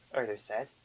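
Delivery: ordinary delivery, nothing marked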